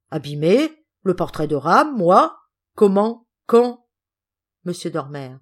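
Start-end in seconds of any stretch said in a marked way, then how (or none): 0.1-3.9 louder, disbelieving little questions; mock surprise, rising word by word; feigned astonishment. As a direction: play innocent, amazed at the accusation. Son feigns astonishment at the accusation.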